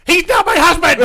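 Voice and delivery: very loud, high yelled denial; imitated screaming. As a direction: shriek the denial like an outraged woman. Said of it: "Heidi's still screaming".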